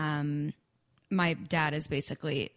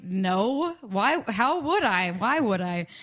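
louder, incredulous string of questions; retold bafflement; 4 semitones higher, 5 dB louder, slower than usual. Singer retells answering tourmate, baffled. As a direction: protest in confusion at an odd question.

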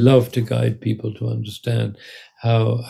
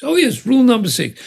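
high, light 'oh, of course' reply; breezy explanation; 12 semitones higher than usual. Acted. Prime minister explains his rule to colleague, breezy. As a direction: toss it off brightly, pitched up.

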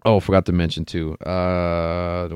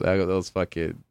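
normal talking voice

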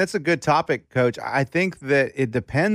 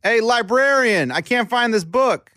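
high, drawn-out customer's call; pitched-up hail, not fully shouted; mock calling out; 7 semitones higher than usual. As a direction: holler for a librarian's help. Acting a customer calling librarian.

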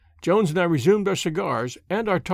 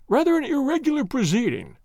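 high-pitched doubtful policeman; disapproving; 8 semitones higher than usual. A narrator's reading. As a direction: object with official reluctance, pitched up. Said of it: "said Jones, shaking his head".

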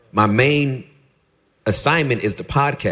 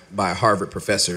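normal talking voice

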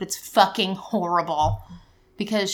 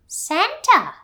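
put-on high excited little girl; toddler voice; 11 semitones higher than usual. Mom imitating her toddler guessing Santa, high and excited. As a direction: squeal it like a delighted toddler.

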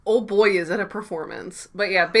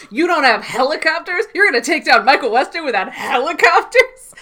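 high, loud, disbelieving questions; mock incredulity; 7 semitones higher, 5 dB louder than usual. Sam needles Oksana about taking down Michael Weston, mock incredulous. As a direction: act out exaggerated outrage at the bad plan.

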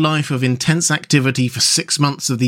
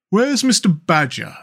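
mole character; 4 semitones higher, slower than usual. Acted. Voices the mole.

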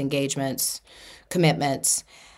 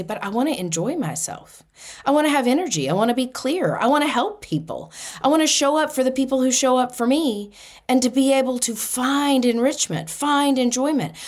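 higher, bright hopeful wishes; eager; 8 semitones higher than usual. Retold or imitated. Retelling her new motivations, eager and higher. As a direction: say it like you really mean it. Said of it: "and I say".

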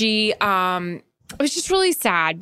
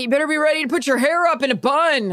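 slightly raised, imitated pushy stranger; mock the overeager passenger; lightly put-on pushy passenger voice; 7 semitones higher than usual. Retold pushy passenger quote.

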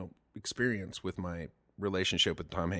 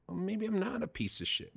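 hopeful inner voice; 11 semitones higher, 3 dB quieter than usual. Retold or imitated.

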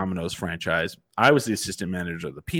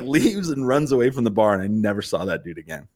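usual speaking voice

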